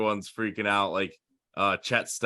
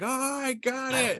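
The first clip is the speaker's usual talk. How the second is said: much higher, slow mock delight; old owner's delight; slower than usual. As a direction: draw it out like a thrilled old man. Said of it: "He's like".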